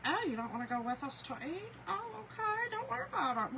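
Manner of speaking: mocking family. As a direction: mimic the family pretending not to mind. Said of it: "They'll be like".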